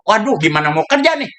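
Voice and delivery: ordinary delivery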